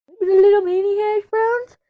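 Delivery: very high, loud little squeak; tiny cutesy voice. Pretend. Streamer adding mini hash browns to breakfast list, tiny cutesy voice. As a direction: squeak it like something miniature.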